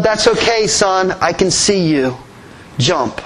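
ordinary delivery

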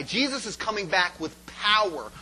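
normal talking voice

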